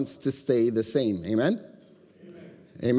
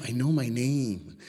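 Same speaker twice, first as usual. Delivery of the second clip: mildly exasperated, stretching each word; retold insistent protest; 3 dB quieter, slower than usual. Boy correcting the teacher about his own name, mildly insistent.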